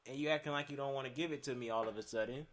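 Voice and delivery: re-argue it, heated but half-performed; retold argument.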